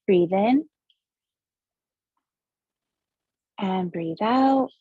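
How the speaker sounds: slow meditation guide, long pauses between breaths; guided breathing meditation. Acted. Host guides listeners through seven breaths, measured meditation voice.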